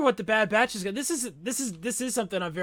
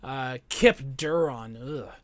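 usual speaking voice

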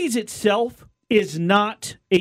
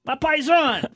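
loud, much higher Italian American New York voice, shouting the greetings; Italian American goomba; 8 semitones higher, 3 dB louder than usual. Retold or imitated. Host imitating Italian Americans greeting each other, loud hammed-up Italian American accent. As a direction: ham up a Jersey Italian American paisan calling out to his buddy. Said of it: "it's like".

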